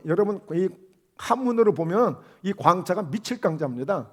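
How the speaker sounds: ordinary talk, nothing marked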